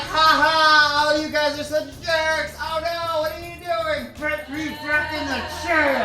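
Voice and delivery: high, put-on panicky good humour; hammed-up high-pitched pleading; feigned playful protest. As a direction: protest in a strained high voice, covering up. Pretends helplessness after being tipped over.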